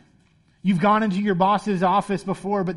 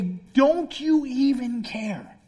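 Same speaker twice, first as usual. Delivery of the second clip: slow, raised-pitch plea with a touch of fear; mildly acted terror; 4 semitones higher, much slower than usual. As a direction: draw it out as a frightened plea. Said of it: "so terrified, and they say".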